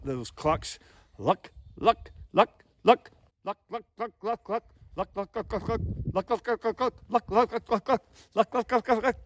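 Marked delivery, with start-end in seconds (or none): 1.2-3.1 single LUCK syllables, high and spaced apart; slow clucks. Shown as a demonstration. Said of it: "how to speed those notes up". 3.4-9.2 rapid high bursts of LUCK; speeded-up clucks. Shown as a demonstration. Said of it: "You start speeding that up".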